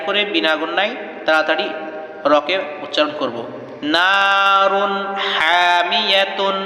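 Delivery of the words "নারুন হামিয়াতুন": নারুন হামিয়াতুন slow, the ন of নারুন said clear before হা with no nasal hum; clear izhar recitation. Shown as a demonstration. Teacher demonstrates to students how to pronounce it. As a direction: keep নারুন's নুন crisp and quick. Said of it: "এইভাবে।".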